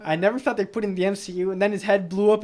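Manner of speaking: ordinary talk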